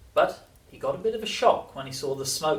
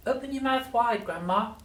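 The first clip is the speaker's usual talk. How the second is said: high-pitched grandson character voice; high voice, easing down on the last word; George coaxing Grandma; 10 semitones higher than usual. A narrator's reading. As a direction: pitch it up as an eager storybook child. Voicing George addressing his grandmother.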